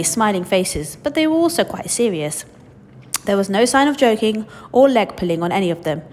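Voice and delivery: usual speaking voice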